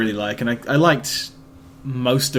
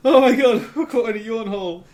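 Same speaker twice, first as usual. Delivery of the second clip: laughing; 9 semitones higher than usual. Real, not acted. Laughing fit over yawns.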